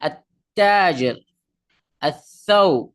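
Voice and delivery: "আত-তাজির" and "আত-থাউব" with long, forceful doubled consonants; tashdid doubling. Shown as a demonstration. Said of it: "তাসদীদ মানে double".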